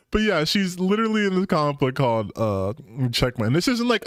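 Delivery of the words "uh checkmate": ordinary talk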